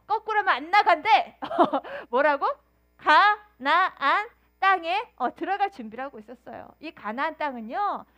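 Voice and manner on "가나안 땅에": slow, loud, drawn-out answer. Real, not acted. Teacher drawing out the answer for the children, slow and loud.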